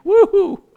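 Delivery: high sarcastic woo-hoo; mock cheer. Preacher whooping sarcastically about being crowded with trials. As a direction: whoop it in jest.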